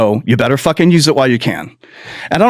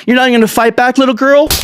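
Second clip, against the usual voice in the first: mock bully taunt; 9 semitones higher, faster than usual. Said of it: "he's like".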